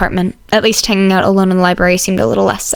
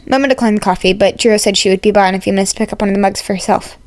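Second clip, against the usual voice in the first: ordinary delivery, nothing marked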